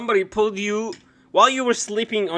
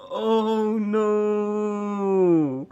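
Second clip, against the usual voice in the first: ordinary talk, nothing marked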